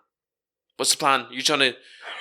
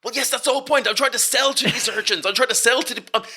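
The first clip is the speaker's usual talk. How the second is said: higher-pitched, exasperated insistence; exasperated protest; 8 semitones higher, faster than usual. Acted. Business partner protesting that the urchins are customers, exasperated. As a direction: argue it back in high-pitched exasperation.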